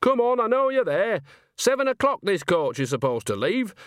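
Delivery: high-pitched calling out. A narrator's reading. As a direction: call across the street, pitched well up. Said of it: "She raised her voice".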